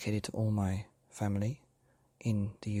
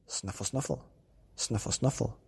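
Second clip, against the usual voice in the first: hedgehog snuffle. Acted. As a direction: sniff like a hedgehog. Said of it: "went the hedgehog".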